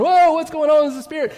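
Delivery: panicked at seeing a ghost; freaking out. Pretend. Disciples cry out in fright at a spirit, high and loud.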